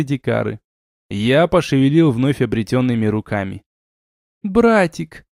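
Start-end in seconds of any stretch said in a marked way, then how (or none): 4.4-5.2 high-pitched call to a brother; sister's cry. A narrator's reading. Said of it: "крикнула мгновенно проснувшаяся Дуняша".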